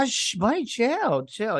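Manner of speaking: high, hurried plea to settle down; imitated frantic shushing. Character hushing the one yelling for Mom, imitated high plea. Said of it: "He's like".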